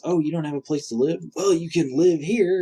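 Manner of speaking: imitating a stranger's cheery offer; eager stranger. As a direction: pitch it up like an over-helpful stranger. Acting out a stranger's friendly offer.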